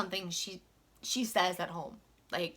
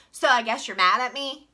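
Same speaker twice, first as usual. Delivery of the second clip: imitating coworker; 2 semitones higher, 8 dB louder than usual. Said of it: "this is what she says, just like this".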